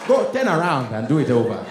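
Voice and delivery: loud, scolding Nigerian GPS. Retold or imitated. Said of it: "a Nigerian on a G P S".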